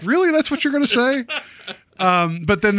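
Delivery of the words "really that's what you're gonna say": high-pitched mock disbelief; much higher, incredulous questioning; incredulous retort. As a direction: react as if you can't believe they said it. Mock reaction to someone's dumb remark.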